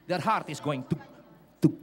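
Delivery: ordinary delivery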